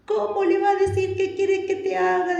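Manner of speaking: high-pitched, whiny mimicking complaint; mock indignation. We who analyse everything questioning Jesus, high mock indignation. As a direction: object in a high silly voice. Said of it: "decimos nosotros".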